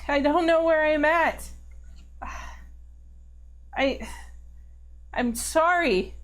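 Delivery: high, halting, upset confession; tearful distress. Acted. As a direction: break into a pained apology, faltering. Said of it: "And she's just sobbing now".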